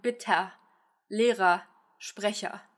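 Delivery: 'bitte, Lehrer, Sprecher' each ending in ah; A schwa endings. Shown as a demonstration. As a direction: say 'bitte' as bitt-ah, likewise 'Lehrer', 'Sprecher'. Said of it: "more like an A than an Ö".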